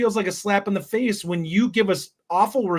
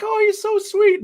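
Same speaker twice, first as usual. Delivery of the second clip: put-on a much higher, sweet little old lady voice; 4 dB louder than usual. Old lady at the nursing home fooled by the grifter, sweetly cooing. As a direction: coo it like a trusting grandma. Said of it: "the old lady being like".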